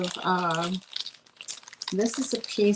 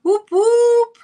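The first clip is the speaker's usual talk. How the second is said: high, loud whoop whoop; celebratory whoop; excited cheer; 13 semitones higher, 11 dB louder than usual. Real, not acted. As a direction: cheer them in with a joyful whoop. Cheering a friend's arrival.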